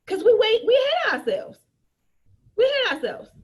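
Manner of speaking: high, loud groaning complaint; exasperated cry. Real, not acted. A coach mocking sellers who hate their product, high and loud. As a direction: throw up your hands and wail it. Exclaiming frustration at unsold kit.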